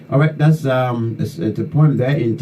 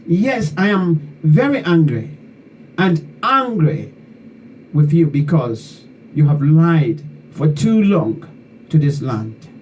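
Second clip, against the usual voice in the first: slow, higher-pitched declaiming with indignant repetition; angry poem recital; 4 semitones higher than usual. Acted. Poet reading own poem to those who betrayed Liberia, indignant declamation. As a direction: recite an indignant protest poem, drawing out key words.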